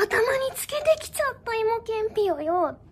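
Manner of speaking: 高い声で大げさに嘆く芝居; やらかしに気づいて高く嘆く; 恥じらう女の子. Acted. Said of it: "恥ずかしすぎる". (恥ずかしがる芝居)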